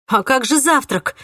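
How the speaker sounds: high, loud outraged question; protest, offended; indignant. A narrator's reading. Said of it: "возмутилась я".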